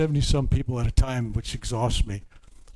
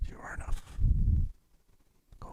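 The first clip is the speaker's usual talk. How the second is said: soft unvoiced whisper; whispered blessing; 5 dB quieter, faster than usual. Real, not acted. Healer whispers a blessing to a kneeling seeker. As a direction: breathe it close like a secret. Hushed blessing during healing.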